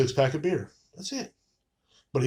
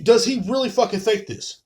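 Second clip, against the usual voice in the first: normal talking voice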